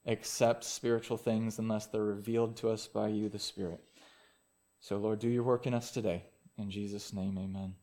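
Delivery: soft, hushed prayer voice. Real, not acted. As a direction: pray quietly and sincerely over the people. Pastor prays before sermon.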